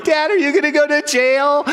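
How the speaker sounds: high-pitched imitation of an upset child; crying kid. Crying kids in the back seat asking Dad, high mock wail. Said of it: "The kids are crying".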